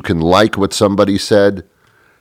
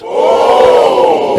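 fake gasp; 4 dB louder than usual. Fake impressed sound.

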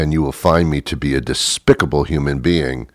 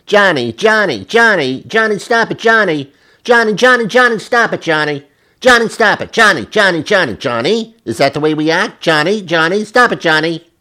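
very high, piercing mock-mom voice; constantly nagging mother; 12 semitones higher than usual. Mother at nearby table telling Johnny to stop, nagging. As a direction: repeat it relentlessly, scolding. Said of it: "And she's constantly saying".

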